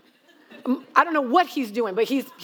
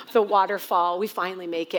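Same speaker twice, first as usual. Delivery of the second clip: normal talking voice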